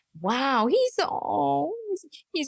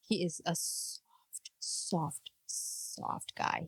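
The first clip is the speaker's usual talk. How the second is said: very soft, slow whisper; breathed with exaggerated delicacy; hushed mock tenderness; 6 semitones lower, 13 dB quieter, much slower than usual. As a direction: stretch it out in a low, barely-there whisper. Hushes to dramatize how soft he is.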